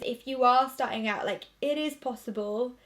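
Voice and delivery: ordinary delivery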